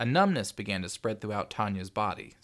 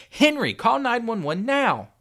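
slow, high, very insistent yell; desperate mother screaming a command; frantic shout; 12 dB louder than usual. A narrator's reading. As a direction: shout each word as an urgent order. Frantic mother orders son to call.

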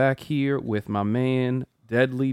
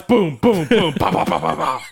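punching sound effects; 5 semitones higher, 5 dB louder than usual. Retold or imitated. Mimicking punches in retold fight.

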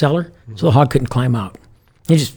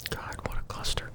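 whispered aside; 18 dB quieter than usual. Real, not acted. Sighs at the memory.

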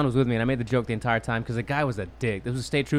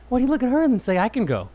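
higher, mildly indignant retelling; indignant protest; 7 semitones higher, 5 dB louder than usual. Acted. Comic retelling his protest at being let go, indignant. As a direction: protest it in mock disbelief, pitched up.

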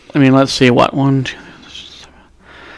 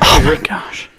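breathy whispered exclamation; whispering; 5 dB quieter than usual. Real, not acted. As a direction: whisper it, worn out from laughing. Whispered after laughter.

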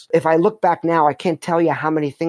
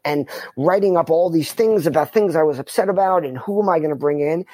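usual speaking voice

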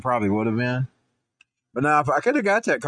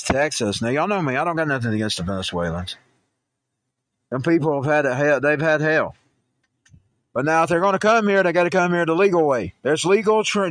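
usual speaking voice

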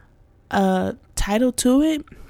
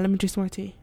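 ordinary talk

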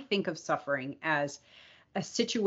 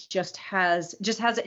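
ordinary talk

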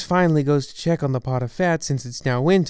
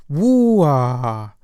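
surprised exclamation; 4 dB louder than usual. Acted. Mouse exclaims in story.

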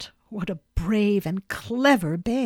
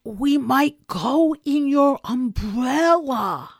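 louder, higher, slow, every word spelled out; louder repeat; 6 semitones higher, 5 dB louder, slower than usual. A narrator's reading. Pooh repeats his umbrella idea louder, slowly and higher, so Christopher Robin hears. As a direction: make sure he hears it this time. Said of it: "Hmm? asked again".

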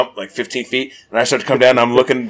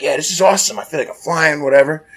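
mock thrill, pitched up; retold excitement; 6 semitones higher than usual. Telling a friend of high bouncing, mildly excited. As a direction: play carefree glee, a little put-on.

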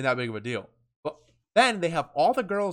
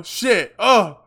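sudden panic; 7 semitones higher, 9 dB louder than usual. Retold or imitated. Panic during pat-down.

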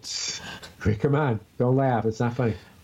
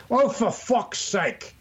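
exasperated; 9 semitones higher, much slower than usual. Pretend. Mock exasperation.

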